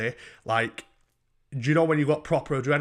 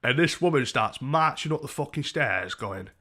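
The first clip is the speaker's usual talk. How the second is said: normal talking voice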